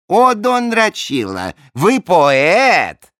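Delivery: high, drawn-out admiring cry; lightly voiced delighted king.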